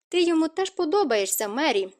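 mildly caustic. A narrator's reading. Kate retorts to Mary that he likes her too, mildly caustic. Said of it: "їдко відповіла Кейт".

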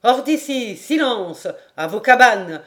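loud theatrical commands; crying out orders. Acted. Quoting Shakespeare's boatswain to the king, crying out. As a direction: declaim it as sharp orders. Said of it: "quand il s'écrie".

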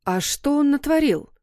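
high-pitched, inquisitive mother; curious question. A narrator's reading. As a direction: perk up, pitch lifted with interest. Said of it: "поинтересовалась мама". (с любопытством, высоким голосом)

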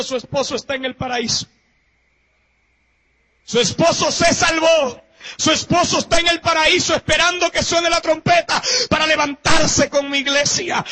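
preacher quoting the Lord to the congregation; preaching at full force. Acted. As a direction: proclaim it like good news.

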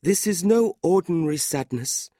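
high, grieving widow voice; tearful widow. A narrator's reading. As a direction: play a sorrowful widow, pitched well up. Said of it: "putting her handkerchief to her eyes".

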